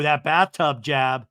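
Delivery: ordinary talk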